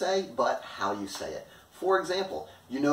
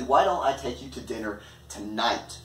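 "tonight" set apart and emphasized at the end; stress on TONIGHT. Shown as a demonstration. Teacher showing possible urgency, stress on "tonight". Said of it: "putting the focus on the word tonight".

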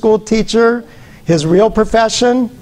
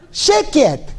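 put-on high Russian-accented teacher snapping "Sheket!"; the exasperated old-country teacher hushing a wild kid, high-pitched; high-pitched Russian-accented hushing yell; mock teacher yelling; 8 semitones higher than usual. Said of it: "This is all I heard".

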